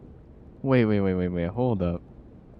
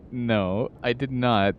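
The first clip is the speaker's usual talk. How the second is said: ordinary delivery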